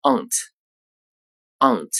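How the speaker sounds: "aunt" with a long back "ah", British style; British pronunciation. Shown as a demonstration. Teacher giving the British "aunt" before the American. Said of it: "英式英语中发作".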